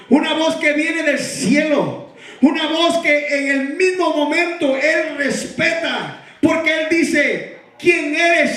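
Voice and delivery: loud, driving sermon with shouted peaks; fiery preaching. Real, not acted. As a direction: preach it at full force to the congregation. Preaching at full force. (predicación encendida y a gritos)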